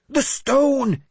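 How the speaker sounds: very high, loud demand; insistent. A narrator's reading. Mad bishop demands the stone, shrilly insistent. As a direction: cry it out insistently. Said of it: "Jeremias insisted".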